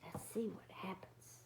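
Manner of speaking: whispered, hushed; suspense whisper. Real, not acted. Reader to children, whispering before the page turn. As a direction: lean in and whisper.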